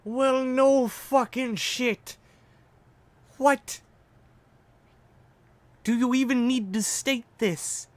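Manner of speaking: high, slow, mocking disbelief; sarcastic. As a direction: drip sarcasm at the obvious. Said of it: "playing my reaction up".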